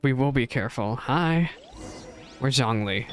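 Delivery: ordinary talk, nothing marked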